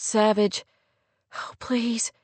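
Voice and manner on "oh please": softly pleading, lightly acted cry. A narrator's reading. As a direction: plead in a small, hushed voice. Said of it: "she said with a soft cry".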